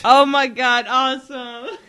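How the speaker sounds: loud, high delighted shout; excited cheer. Real, not acted. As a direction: whoop with joy. Player cheers a correct guess.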